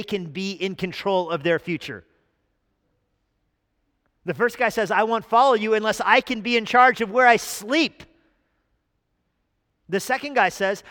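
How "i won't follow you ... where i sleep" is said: higher, louder whiny demand; mock demanding disciple. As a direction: whine it like a spoiled follower. Voicing first disciple.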